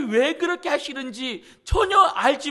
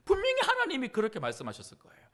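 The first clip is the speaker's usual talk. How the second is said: usual speaking voice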